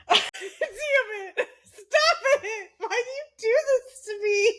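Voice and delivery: high, breaking through giggles; laughing to tears. Real, not acted. Podcast host cracking up at her co-host's joke, laughing to tears. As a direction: try to talk while losing it.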